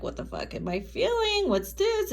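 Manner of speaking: high-pitched baffled question; sudden high confused outburst; confused disbelief. Pretend. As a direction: act out a bewildered lover. Voicing confused person's thoughts.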